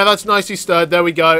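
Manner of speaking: normal talking voice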